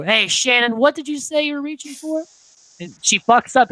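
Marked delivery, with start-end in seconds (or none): 0.0-2.2 higher-pitched, probing call; mock interrogation. Boyfriend questioning Shannon about her story, mock suspicious. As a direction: play the suspicious amateur detective. Acted questioning of a suspected liar.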